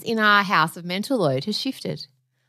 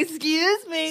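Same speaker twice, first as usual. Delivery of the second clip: teasing imitation of a politician impression, pitched up; mock indignant politician, higher voice; Trump; 8 semitones higher, slower than usual. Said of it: "and you were like".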